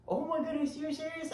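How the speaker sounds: mock wife, high and amazed; surprised wife. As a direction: imitate the wife's stunned delight. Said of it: "And she says".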